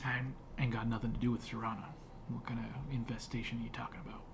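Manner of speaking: a hint of a gruff woodsman; gruff hermit.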